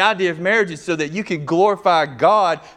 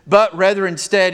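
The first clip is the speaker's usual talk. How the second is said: loud, higher emphasis on "but rather instead"; emphatic; 4 semitones higher, 7 dB louder than usual. Shown as a demonstration. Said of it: "it's very emphatic".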